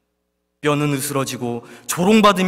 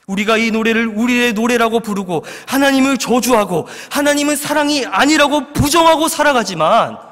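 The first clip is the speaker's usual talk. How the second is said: normal talking voice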